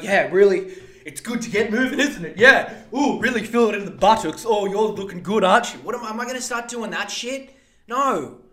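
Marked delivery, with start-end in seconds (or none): 0.0-5.8 chirpy, hammed-up power walker, higher and louder; mock fitness date. Mocking a Tinder date on a power walk, hammed-up chirpy voice. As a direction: gush about exercise like a jogging date. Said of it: "being like".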